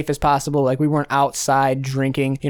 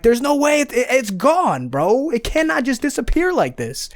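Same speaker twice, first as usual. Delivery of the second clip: much higher, stretched-out disbelieving whine; retold disbelief; 9 semitones higher than usual. Telling friends his lost longboard can't just vanish, high disbelief. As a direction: replay the shock of the lost board, pitched way up. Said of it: "I was so mad".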